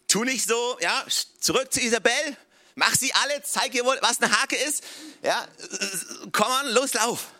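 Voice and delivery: hammed-up impatient God shoving him on; mock drill-sergeant push; pushy urging. God imagined urging Elijah back.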